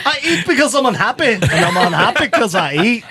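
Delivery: hammed-up Scottish glutton quoting the movie; Fat Bastard impression. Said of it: "Like doing Fat Bastard".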